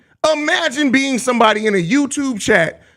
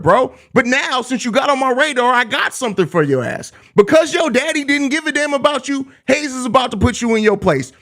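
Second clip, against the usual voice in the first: usual speaking voice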